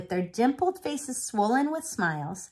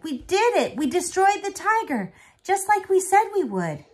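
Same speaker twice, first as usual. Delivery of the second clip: high, loud proud boast; excited boast; 12 semitones higher, 6 dB louder than usual. Acted. Boy bragging to grandfather, high and excited. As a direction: brag about beating the tiger.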